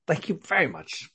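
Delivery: crisp upper-class English accent; posh English voice. Real, not acted. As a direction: sound like a posh English schoolboy. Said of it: "that was a very posh English voice".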